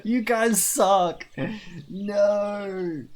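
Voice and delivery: high, slow, drawn-out complaint; hammed-up wail at a betrayal; mock outrage. Streamer scolds chat over haircut options, mock outrage. Protesting chat's haircut choices.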